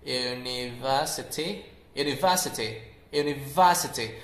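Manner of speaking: British UNIVERSITY as "univa-sity"; British-style UNIVERSITY, crisp T, open VA. Shown as a demonstration. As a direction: drop the R and open VER to VA in UNIVERSITY. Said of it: "You can see that it's univa".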